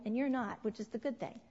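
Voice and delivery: usual speaking voice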